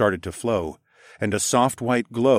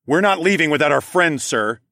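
raised, high insistence dropping on the last word; loud but firm; 7 semitones higher, 5 dB louder than usual. A narrator's reading. Narrator refusing to leave his friend, loud and firm. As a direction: insist loudly, stand your ground. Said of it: "insisted in a loud but firm voice".

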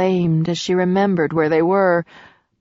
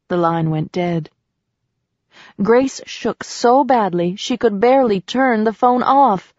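ordinary delivery, nothing marked

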